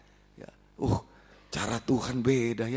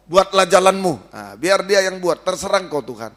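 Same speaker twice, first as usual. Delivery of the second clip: fervent prayer; 5 dB louder than usual. Acted. Leading prayer.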